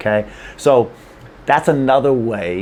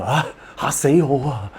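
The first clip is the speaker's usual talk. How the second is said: mock startled; 4 semitones higher than usual. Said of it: "and somebody startles you".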